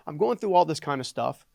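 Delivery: ordinary talk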